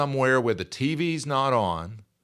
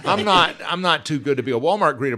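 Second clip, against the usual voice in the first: emphatic protest; 6 semitones higher, 6 dB louder, faster than usual. Real, not acted. Insisting he's not above it.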